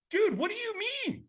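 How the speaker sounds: very high, squeaky mock bewilderment; hammed-up baffled whine; bewildered protest. Acted bewildered reply to stranger.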